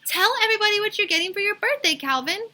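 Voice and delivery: high, loud, fast and excited; bursting to share the news; excited child. Acted. Bibi eager to tell the class, high and excited. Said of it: "Bibi was very excited".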